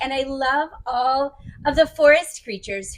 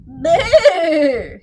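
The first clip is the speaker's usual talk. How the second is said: loud trilled "brr"; shivering brr; 3 semitones higher, 7 dB louder than usual. Acted. Badger tromping in from the cold, loud shivery brr. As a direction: shudder out a big rolling brr. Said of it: "says Badger as he tromps inside".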